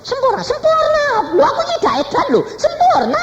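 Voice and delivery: very high, loud, excited cries; hammed-up high squeals; retold jubilant shrieking. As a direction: imitate someone wildly proclaiming a healing. Retold jubilant protest of being healed.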